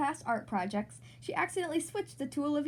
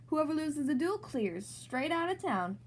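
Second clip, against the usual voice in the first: cowboy drawl; slower than usual. Pretend. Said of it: "in the Wild West".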